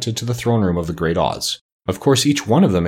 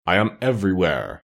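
solemn voice. A narrator's reading. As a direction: intone it like an unseen god. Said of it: "a solemn voice".

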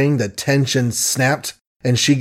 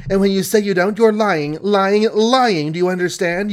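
high-pitched, repetitive angry accusation; furious accusation; 6 semitones higher than usual. A narrator's reading. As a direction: hammer the charge home in a higher voice. Furious accusation of lying in quarrel.

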